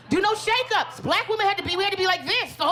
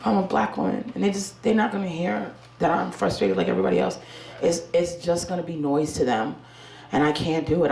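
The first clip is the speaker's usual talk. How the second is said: normal talking voice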